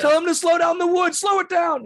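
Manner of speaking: high, full shout across the room; loud, high-pitched shouted command; yelling back. Acted. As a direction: holler an order to someone far behind. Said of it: "he yells back".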